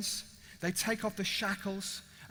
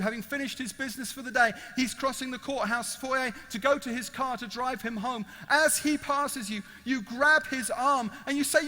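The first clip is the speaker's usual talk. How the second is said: normal talking voice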